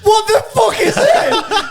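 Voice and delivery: very high, drawn-out comic screech; outraged yell. Retold or imitated. Husband finding clothes laid out ready, outraged high yell. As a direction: screech it in disbelief, half laughing.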